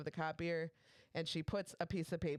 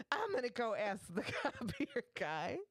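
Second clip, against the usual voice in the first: laughing; 6 semitones higher than usual. Retold or imitated. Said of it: "She's like".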